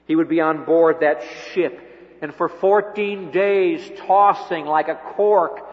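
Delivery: loud, rising pulpit delivery, building to Jesus' words; full-force preaching. Acted. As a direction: preach it out with fire to the congregation. Storm at sea retold.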